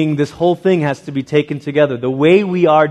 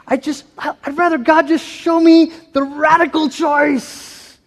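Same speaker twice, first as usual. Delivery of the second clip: dreamy mock voice; 13 semitones higher than usual. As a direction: send up the starry-eyed seeker. Mocking radical choice wish.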